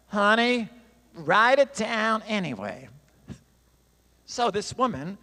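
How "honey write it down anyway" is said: slow, louder Southern drawl, vowels stretched; an affectionate Southern lady coaxing, in a drawl. Retold or imitated. Teacher urging a doubtful student, slow louder Southern drawl. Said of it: "in her inimitable Southern drawl".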